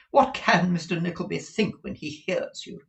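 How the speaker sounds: high, loud reproach; scolding wife. Acted. Wife shames her husband before a guest, high indignant.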